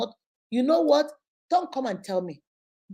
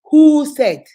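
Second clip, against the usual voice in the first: loud, higher demand, imitating father; father's challenge; 4 semitones higher, 14 dB louder than usual. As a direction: cut off the gossip with a booming question. Her father challenging gossip, acted.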